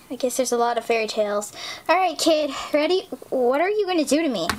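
ordinary talk, nothing marked

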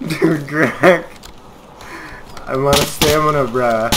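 loud high excited voice; breathless excitement after escaping. Real, not acted. Exhausted, excited after chase.

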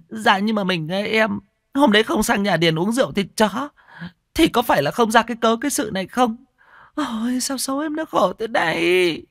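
high, whiny lamenting wife; wailing lament. A narrator's reading. Wife wailing over her unconscious husband, high and whiny. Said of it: "đứng ỉ ôi khóc lóc".

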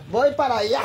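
laughing. Real, not acted. Laughing while chasing husband.

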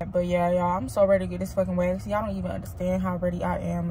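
usual speaking voice

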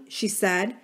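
plain reading voice

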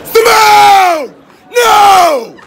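loud yell across room; shouting. Real, not acted. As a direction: yell her name out loud. Shouting to friend.

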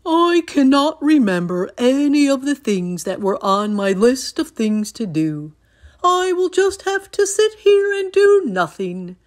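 loud, high, distressed Toad; Toad, upset. A narrator's reading. Toad without his list, loudly upset. Reads Toad's line in story.

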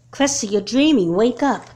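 loud, firm call; waking someone. Acted. Mother waking her daughter from a nightmare, loud. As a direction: shake her awake with your voice.

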